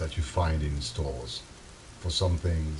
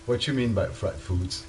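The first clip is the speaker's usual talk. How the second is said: normal talking voice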